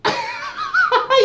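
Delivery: high-pitched cackle of laughter; real high, delighted laugh; burst of laughter. Real, not acted. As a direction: crack up helplessly. Helium experiment, cracks up at voice.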